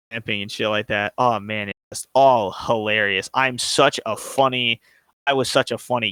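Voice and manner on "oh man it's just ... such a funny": ordinary talk, nothing marked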